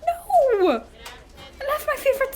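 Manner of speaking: high, hammed-up cry of grief; mock anguish. Mechanic mourns her favourite spanner with mock anguish. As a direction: wail for a lost spanner.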